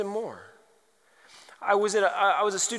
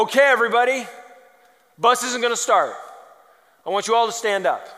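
teacher announcing; 5 semitones higher, 7 dB louder, faster than usual. Retold or imitated. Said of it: "stand up and go".

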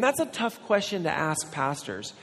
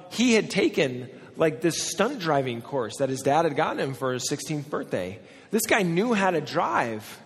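ordinary talk, nothing marked

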